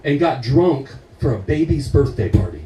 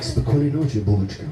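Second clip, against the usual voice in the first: tender goodnight; 6 semitones lower, 5 dB quieter, faster than usual. Acted. Tender goodnight to porn star.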